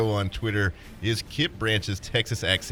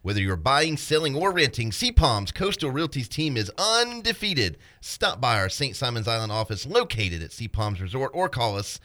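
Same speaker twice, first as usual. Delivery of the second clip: upbeat, higher sponsor-read voice; 6 semitones higher than usual. Acted. Co-host reading the sponsor ad in an upbeat ad voice. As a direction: sell it like a radio spot.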